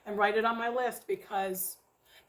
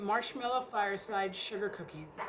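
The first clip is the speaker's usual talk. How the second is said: ordinary talk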